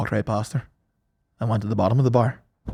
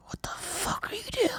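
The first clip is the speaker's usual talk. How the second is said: high, hushed hiss of disbelief; imitated urgent whisper; retold appalled whisper; 13 dB quieter than usual. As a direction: whisper it as an aghast friend. Retells friend's appalled question.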